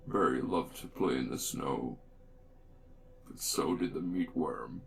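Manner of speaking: very low, ominous horror narrator; spooky voice. Pretend. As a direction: drop deep and intone a mock horror tale. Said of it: "I have to get into the spooky voice".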